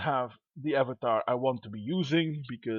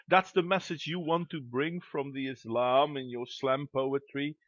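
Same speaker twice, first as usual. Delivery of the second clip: ordinary delivery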